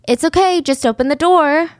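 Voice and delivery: put-on high-pitched reassurance to a child; coaxing pretend mom.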